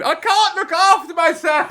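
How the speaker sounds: high, slow, laughing whimper; mock helpless whine. Mocking Pearson from the stands with a high, laughing whimper. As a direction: pretend to be a scared little boy. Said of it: "I was up in the stands going".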